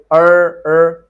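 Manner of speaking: loud curled-tongue American 'er, er'. Shown as a demonstration. Teacher warning students off it, loud American R. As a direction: punch out an American 'er'. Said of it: "in the American accent".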